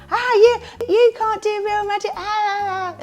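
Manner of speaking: put-on high, loud heckling kid voice; hammed-up bratty child yelling; very loud boy. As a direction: imitate a rowdy eight-year-old jeering. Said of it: "who was just being very loud".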